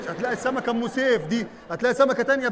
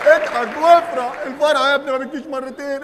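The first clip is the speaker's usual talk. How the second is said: excited; 4 semitones higher, 6 dB louder than usual. Acted. Comedy wave, cheering.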